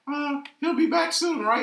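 small, high pleading voice, drawn out; abandoned Charmander. Retold or imitated. Charmander waiting under its leaf, hopeful high voice. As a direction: voice a hopeful little creature, slowly. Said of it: "He was like".

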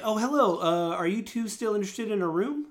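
high, bright welcoming elf hostess; elf innkeeper voice. Acted. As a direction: raise the pitch and greet them like a polite elf clerk. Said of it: "And she's like".

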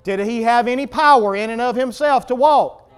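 loud, impassioned sermon delivery; full-force preaching. Real, not acted. Preacher questions congregation about the lame man, full-force preaching. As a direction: drive the questions home to the room.

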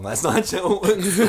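laughing it out, high and loud; can't hold back the laugh on the correction; laughing retort. Retold or imitated. To the opponent at the table, laughing. Retelling rules argument, laughing.

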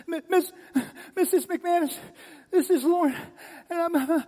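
very high, loud, halting sobbed words; crying, hyperventilating child. Retold or imitated. Boy phones Missus McManus to confess, hyperventilating and crying. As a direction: stammer between gasps, imitating a panicked crying kid. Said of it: "I'm hyperventilating. I'm crying".

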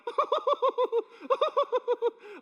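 high burst of laughter; crowd laughing. Retold or imitated. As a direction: cackle out loud at him. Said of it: "everyone's like".

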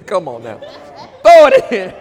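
laughing call to throw it in; amused shouted urging. Acted. Laughing while mocking tossing tithes.

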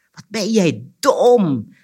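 loud, high, drawn-out scolding; angry shout. A narrator's reading. Anansi yells at the forgetful cricket, angry shout. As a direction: yell it slowly in exasperation. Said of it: "schreeuwde Anansi".

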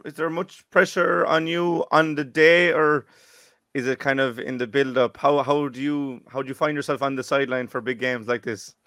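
usual speaking voice

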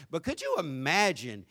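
usual speaking voice

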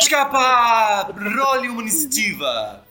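booming slow game master call; dramatic game master shout. Pretend.

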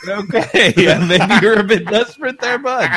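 mock amused put-down through laughter; laughing mock reply. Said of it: "I was like".

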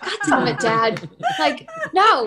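high, exasperated outburst at dad; exasperated retort. Retold or imitated. Player to dad who revealed his secret role, exasperated.

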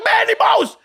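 friend yells at him, mock outrage; imitating an indignant shout. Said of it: "hij zegt".

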